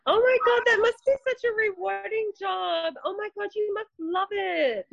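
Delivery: high, breathless imitation of a fan; hammed-up gushing stranger; mock gush. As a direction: squeal it like a starstruck stranger.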